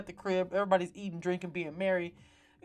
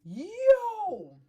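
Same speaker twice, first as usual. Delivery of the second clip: stunned yo; 12 semitones higher, 7 dB louder than usual. Retold or imitated. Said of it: "I literally was just like".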